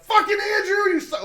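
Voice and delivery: high, loud angry outburst; yelling dad. Retold or imitated. Imitating dad at a family member on the show, angry yell. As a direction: berate a relative on your team. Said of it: "My dad would be sitting there going".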